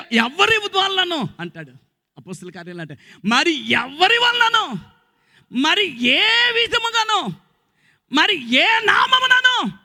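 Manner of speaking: slow, high, building to a shout; fervent preaching. Real, not acted. Preacher building the question of salvation, shouting.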